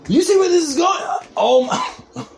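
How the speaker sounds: high-pitched, alarmed outcry; alarmed shout. Real, not acted. Podcaster warning listeners of a conspiracy, alarmed high shout. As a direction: shout it high, as if spotting a plot.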